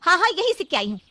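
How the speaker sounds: high, loud comeback; angry retort. Acted. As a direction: fire it straight back at her. Mona shouts back at aunt.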